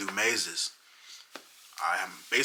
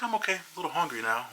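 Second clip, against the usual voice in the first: put-on dreamy girlfriend; 8 semitones higher, faster than usual. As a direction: float it out lazily, no concern. Voicing high girlfriend.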